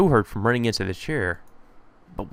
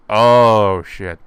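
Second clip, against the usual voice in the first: startled; 2 semitones lower, 8 dB louder than usual. Real, not acted. Realizing chair destroyed.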